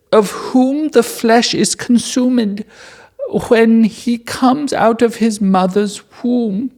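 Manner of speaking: high, slow pleading reading voice; dramatic scripture reading. Acted. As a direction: plead the verses solemnly. Reads Aaron's plea.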